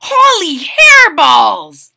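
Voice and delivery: high, loud, slow shocked cry; exclaimed. A narrator's reading. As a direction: gasp it at the mirror. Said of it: "exclaimed Skippy John Jones".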